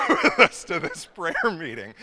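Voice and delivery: loud laugh through the question. Real, not acted. Quoting uncle's complaint about the lunatic, laughing. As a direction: barely get the question out for laughing.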